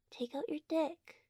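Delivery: ordinary talk, nothing marked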